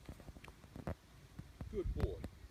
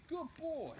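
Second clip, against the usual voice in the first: much higher praise for the dog; high happy dog praise; 9 semitones higher, 4 dB quieter, much slower than usual. Real, not acted. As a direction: reward him with a lifted voice.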